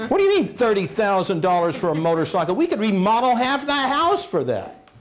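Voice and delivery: husband imitating his wife's outraged protest; nagging wife. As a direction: play the outraged wife over the price. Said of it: "He said, "Wives".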